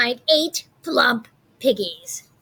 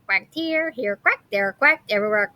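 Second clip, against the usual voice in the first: plain reading voice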